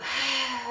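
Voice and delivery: long breathy sigh out; audible exhale sigh. Shown as a demonstration. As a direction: sigh it all out. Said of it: "sigh it out".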